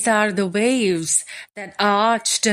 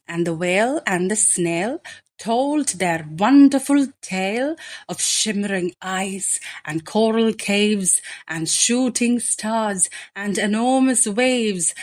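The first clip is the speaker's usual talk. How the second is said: plain reading voice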